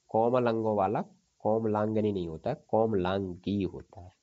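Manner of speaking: usual speaking voice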